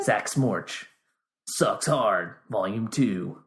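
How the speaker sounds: low, movie-trailer announcer. Acted. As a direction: announce it like a film title. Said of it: "my trailer voice".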